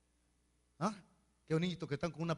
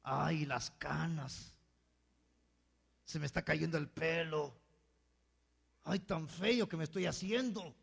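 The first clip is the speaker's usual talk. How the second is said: mock lament; 3 semitones lower, 6 dB quieter than usual. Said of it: "le reclaman a Dios cuando se ven en el espejo".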